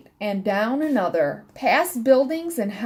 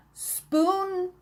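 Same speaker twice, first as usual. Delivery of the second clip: plain reading voice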